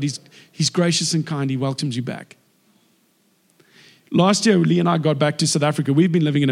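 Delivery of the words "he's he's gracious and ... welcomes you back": ordinary talk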